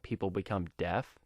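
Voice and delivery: plain reading voice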